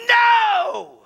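very high, loud scream of refusal. Retold or imitated. Grieving man in the prayer room, freaked-out scream. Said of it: "and I scream".

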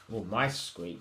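ordinary delivery

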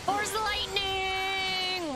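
excited. Real, not acted. Spotting force lightning on screen.

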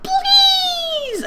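very high, drawn-out wailing plea; desperate cry. Acted. Girl begging the kidnapper in a very high wail. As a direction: wail it in hammed-up despair. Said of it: "I cried".

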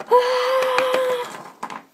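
sudden high-pitched yelp; high cry. Pretend. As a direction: let out a shrill little cry. Sudden cry after remark.

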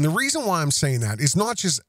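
usual speaking voice

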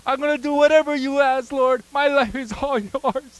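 rising, loud devotion to God; fervent vow. Retold or imitated. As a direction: pour out a heartfelt pledge. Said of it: "I was like".

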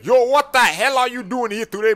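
loud, high-pitched challenge; heated confrontation. Acted. Confronting someone in an office.

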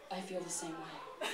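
very low, hushed mock-lustful admission; husky with lust. Jon admitting desire to Colbert, husky with lust. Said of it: "His voice husky with lust, he admitted".

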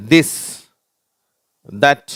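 this, that each set apart with a voiced dh; model reading. Shown as a demonstration. Teacher reading for pupils to copy, model reading. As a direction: read this, that as separate words, dh at the front. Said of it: "இப்ப நான் வாசிக்கிறேன்".

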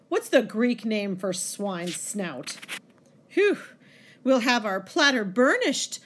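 mocking monologue with swoops in pitch; dramatic poem reading. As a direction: act out the monk's scorn.

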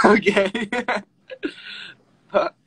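high, loud okay breaking into laughter; tearful, laughing okay; emotional laughing. Real, not acted. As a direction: burst out laughing while trying to compose yourself. Failing to stop being emotional.

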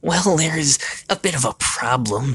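high goblin messenger voice; high-pitched, hesitant goblin underling; small warty goblin. A narrator's reading. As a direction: pitch it up as a little goblin breaking bad news. Goblin interrupts the shadow king.

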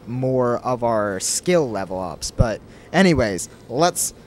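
usual speaking voice

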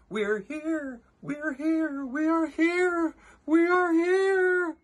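very high, swelling tiny-crowd cry; crying out in fear. A narrator's reading. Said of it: "They cried out in fear".

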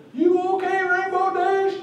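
very high, squeaky pony voice; original Derpy voice. Retold or imitated. Panelist contrasting the original line, high and squeaky. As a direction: pitch way up into a squeaky cartoon voice. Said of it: "So instead of".

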